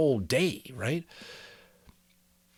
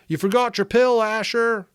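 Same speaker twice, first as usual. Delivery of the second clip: put-on much higher, louder call across to a boy; father shouting a reminder from the door; 13 semitones higher, 8 dB louder than usual. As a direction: call him back from the doorway. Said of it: "had called from their dwelling doorway".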